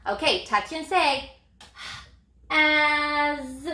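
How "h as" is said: "as" stretched after a lone h, ending in buzzed z; sounded out with z. Shown as a demonstration. Teacher shows the S spelling z, "as" sounded out slowly. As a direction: draw out "as" into a long z. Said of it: "I made the z sound".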